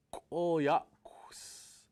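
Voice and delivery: plain reading voice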